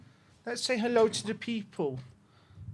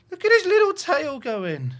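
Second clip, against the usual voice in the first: excited; 7 semitones higher, 10 dB louder than usual. Real, not acted. Cooing at wagging dog.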